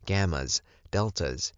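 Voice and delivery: ordinary delivery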